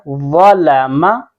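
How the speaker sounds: व लामा slowly, rounded thick "vaw", light "laa-ma"; heavy व, then thin लामा; heavy first letter. Shown as a demonstration. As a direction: stretch व लामा with a full-mouthed first syllable. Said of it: "वाव को भी मोटा पढ़ना है".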